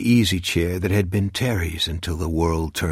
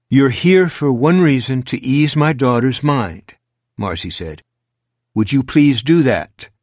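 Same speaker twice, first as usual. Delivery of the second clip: louder, higher, tense and pleading; a mother pushing back hard; firm protective mother; 6 semitones higher, 8 dB louder than usual. Acted. Mother defends daughter to detective.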